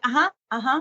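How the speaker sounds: absent-minded listener's uh-huhs; mock half-attentive mumbled agreement; not present. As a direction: acknowledge without really listening. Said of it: "you could tell they're not present".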